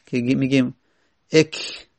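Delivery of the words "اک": اک as "ek", ending on a bare k; sakin kaf. Shown as a demonstration. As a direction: cut اک off on the k with no vowel after it. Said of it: "این کاف که ساکن داره".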